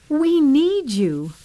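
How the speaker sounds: slow, high, drawn-out plea; begging. A narrator's reading. Widow to her dying friend, slow desperate plea. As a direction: lean on each word, pleading loudly. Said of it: "the widow begged".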